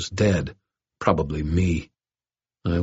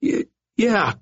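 much higher, stammering fear; stammered. A narrator's reading. Dangling bully stammering in fear. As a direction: stutter out a terrified yes. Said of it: "stammered the dangling brother".

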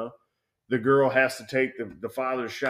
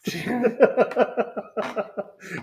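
long falling laugh, much higher; 11 semitones higher, 5 dB louder than usual. Real, not acted. Laughing at his own story, long and high. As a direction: let a laugh roll out.

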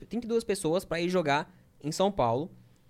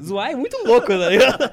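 laughing; 7 semitones higher, 11 dB louder than usual. Real, not acted. Laughing about teasing.

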